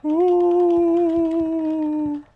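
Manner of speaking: high squealing whoops; playful whoopee. Acted. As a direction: squeal it like a teasing kid. Repeats whoop to annoy character.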